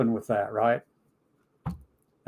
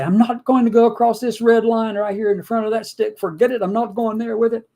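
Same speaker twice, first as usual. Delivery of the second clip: higher, mock-stubborn refusal; 8 semitones higher than usual. Mocking the religious refuser who won't budge, higher and sneering.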